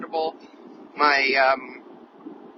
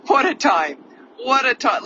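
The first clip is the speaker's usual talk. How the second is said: exclaiming; 9 semitones higher, 8 dB louder than usual. Retold or imitated. Retold self-exclaiming about the lockdown.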